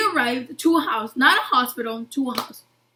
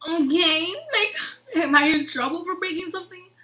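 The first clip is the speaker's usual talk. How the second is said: higher, hesitant retold question; half-laughing, pitched up in worried disbelief; nervous pupil; 5 semitones higher than usual. Pupil asked to stay after class, nervously puzzled.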